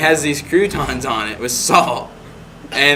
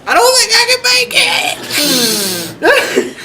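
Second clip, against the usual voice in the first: high, loud, fast words sobbed out; crying wail; 3 dB louder than usual. Retold or imitated. To the principal offering the nurse, a high loud sobbing wail. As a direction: blurt it out through sobs, barely holding on.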